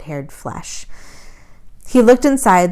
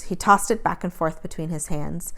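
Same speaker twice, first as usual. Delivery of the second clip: ordinary delivery, nothing marked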